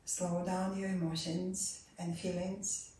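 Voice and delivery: normal talking voice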